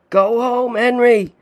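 high, loud shout above the splashing; shout over the din. A narrator's reading. Trapped father sending son away, shouting over the din. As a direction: yell it over the noise. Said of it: "he shouted over the din".

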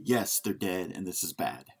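normal talking voice

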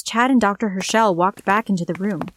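ordinary delivery

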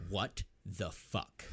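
low-key, deliberate mock shock; retold stunned disbelief. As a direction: space out each word, mock stunned. Retold stunned reaction of Jackson.

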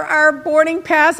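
ordinary delivery

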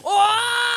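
high battle grunt; imitated grunt. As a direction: yell like a game hero.

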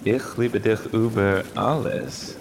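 Ich liebe dich über alles, slow and careful German. Shown as a demonstration. Wife teaching husband German phrases, slow and careful. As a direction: say each German word deliberately. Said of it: "teaching German".

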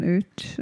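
"oot." said as a Canadian out, with a tight oo. Retold or imitated. Demonstrating the Canadian out to a friend, light accent. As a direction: round "oot." into a Canadian vowel. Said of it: "They kinda do the Canadian".

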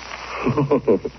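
distant, echoing sinister laugh; eerie laugh. Acted. Narrator's memory breaks into a far-off eerie laugh. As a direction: laugh as if from the dark. Laugh after approaching footsteps.

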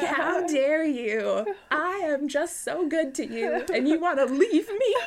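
imitating an offended vampire breaking into laughter; mock angry Dracula. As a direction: wave the letter and rage theatrically. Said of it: "waving the letter angrily".

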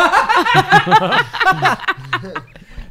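long high-pitched laugh. Real, not acted. Hurt by the mix-up.

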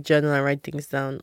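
ordinary talk

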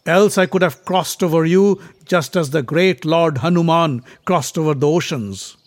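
declaiming prince. A narrator's reading. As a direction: proclaim it forcefully. Bhima declaims to the monkey.

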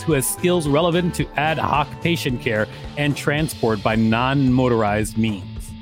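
ordinary talk

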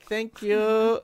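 small, high-pitched put-on voice; mock meek thanks. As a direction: act out timid, awkward gratitude. Meek reply after being spat at.

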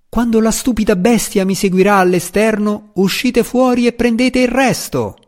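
high, carrying shout. A narrator's reading. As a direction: call out a decoy to the dragon. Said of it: "Drist urlò". (grido acuto per ingannare il drago)

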